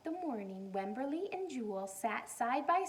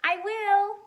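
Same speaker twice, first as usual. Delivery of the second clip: much louder, higher happy shout; excited call; 10 semitones higher, 15 dB louder than usual. Acted. Wemberly calling back happily to her teacher. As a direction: call back brightly over the shoulder. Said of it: "she said".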